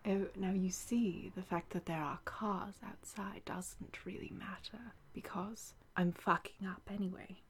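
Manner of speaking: lightly put-on English accent, kept quiet; gentle English accent. Shown as a demonstration. Reassuring listeners about cars outside, gentle English accent. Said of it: "I could do something very gentle".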